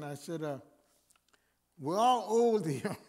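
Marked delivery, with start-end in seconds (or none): 1.8-3.1 laughing. Retold or imitated. Preacher joking with elderly residents, laughing. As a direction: laugh as you tell the old folks. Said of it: "And I said, "Listen".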